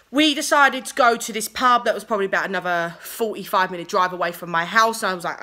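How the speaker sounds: normal talking voice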